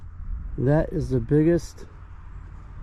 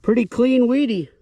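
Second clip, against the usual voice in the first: excited; 8 semitones higher, 4 dB louder than usual. Real, not acted. Excited find of a clean coin.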